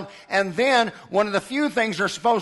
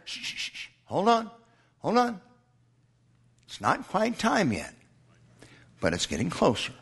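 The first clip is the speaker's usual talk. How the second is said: soft shushing, then softer calm words; quiet them down like a patient father; hushing; 2 semitones lower, 7 dB quieter, faster than usual. Acted. Preacher voicing God calming the martyrs, hushed and soothing. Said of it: "You know what God said?".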